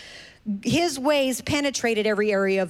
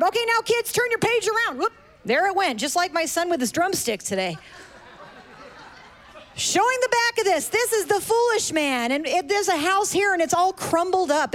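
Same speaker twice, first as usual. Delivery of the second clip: put-on high, bouncy kids' host voice; singsong puppet show host; 6 semitones higher than usual. Talking to the kids.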